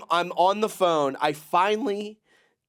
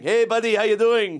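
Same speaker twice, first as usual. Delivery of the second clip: cheery driver; 7 semitones higher, 5 dB louder than usual. Retold or imitated. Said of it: "he's going".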